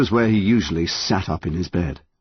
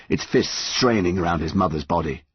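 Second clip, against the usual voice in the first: ordinary delivery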